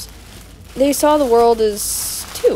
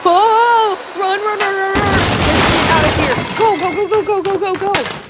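frantic shouting; 7 semitones higher, 5 dB louder than usual. Acted. Fleeing the collapsing ruin.

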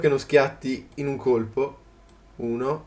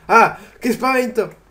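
louder, higher cry of fright; startled cry; 7 semitones higher, 6 dB louder than usual. Real, not acted. Gamer caught by a sudden creature, loud startled cry. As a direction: jump at the scare. Jump scare in game.

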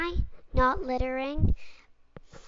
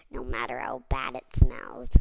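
much lower gruff creature; deep gruff voice; 13 semitones lower, 3 dB quieter than usual. Acted. A low creature remarks on the smell. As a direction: drop into a low grumbling voice.

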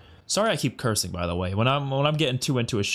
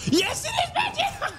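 very high, laughing shout of joy; real thrilled scream through a laugh; excited shout. Real, not acted. Favourite character returns on screen.

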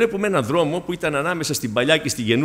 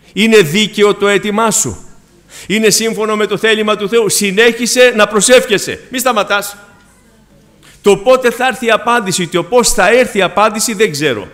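preaching; 4 semitones higher, 6 dB louder than usual. Acted. Preacher urges continued prayer.

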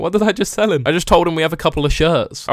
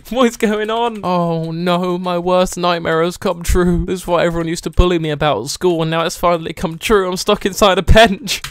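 mock despair; 3 semitones higher than usual. Shopkeeper trapped inside a bench, hammed-up despair. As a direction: lament it like a nightmare come true. Laments being stuck in bench.

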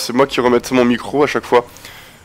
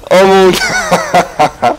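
breathless laugh; 5 semitones higher, much slower than usual. Real, not acted. Gasping laugh at game scene.

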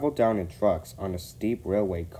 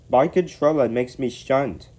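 higher, louder sheepish grumble; 6 semitones higher, 7 dB louder than usual. A narrator's reading. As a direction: own up sheepishly in a raised voice. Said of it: "said sheepishly".

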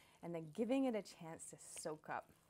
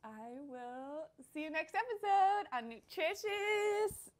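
high, loud, drawn-out show title; upbeat sign-off; 7 semitones higher, 8 dB louder than usual. Real, not acted. Host signing off until next episode, exuberant. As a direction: sing out the catchphrase, slowly building.

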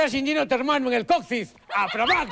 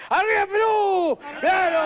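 high, shouted team cheer; hammed-up coach's cry, pitched high; rallying cheer; 6 semitones higher than usual.

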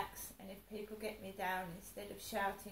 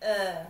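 mother's loud uh; 16 dB louder than usual. Retold or imitated. Mother hesitates on voicemail.

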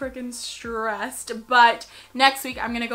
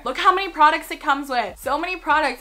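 excited; 4 semitones higher, 7 dB louder than usual. Real, not acted. Hyped over brow kit.

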